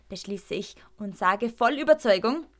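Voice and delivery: plain reading voice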